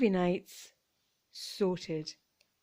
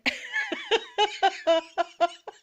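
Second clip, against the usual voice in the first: high helpless giggle; 11 semitones higher than usual. Real, not acted. Laughing at the chaotic shelf display, high giggle. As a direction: burst into a giggle at the mess. Laughing at her own chaos.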